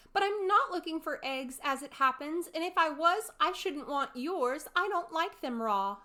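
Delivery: put-on a little girl losing sight of her hands; Alice, alarmed. A narrator's reading. Alice answering.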